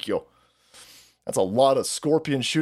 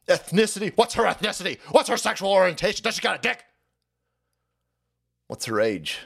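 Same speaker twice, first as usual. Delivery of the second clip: ordinary delivery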